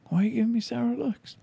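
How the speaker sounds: high, puzzled innocent voice; mock bewildered question; puzzled self. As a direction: play dumb, all innocence. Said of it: "And I'm like".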